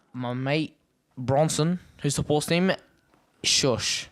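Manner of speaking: ordinary talk, nothing marked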